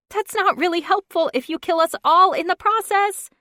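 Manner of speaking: high, loud squeaky protest; squeaked. Acted. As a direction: squeak it in fright. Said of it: "she squeaked".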